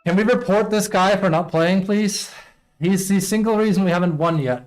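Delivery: loud, high-pitched angry rant; infuriated. Real, not acted. Player demanding a teammate be reported, infuriated. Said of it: "It's honestly infuriating to watch him play".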